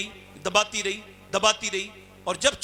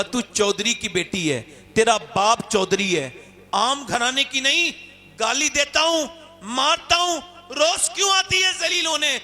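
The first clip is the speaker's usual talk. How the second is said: starts plain, climbs high and loud; a harsh landlord rising to a demand; scolding builds; 6 semitones higher, 4 dB louder than usual. Acted. Chaudhry scolds the girl.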